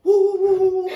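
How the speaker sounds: high, loud woofs; imitated dog barking. Telling friends how chained dogs barked at them, woofing. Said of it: "they're barking at me".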